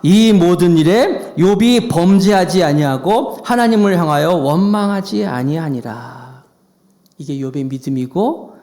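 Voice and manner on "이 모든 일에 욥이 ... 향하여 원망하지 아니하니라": slow group scripture reading. Real, not acted. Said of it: "다 같이 시작".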